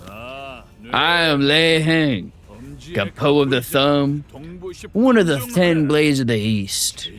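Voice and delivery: Lei Heng's formal challenge, slow and weighty; slow solemn warrior. Acted. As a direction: proclaim it like a duelist before the strike. Reads Lei Heng's declaration.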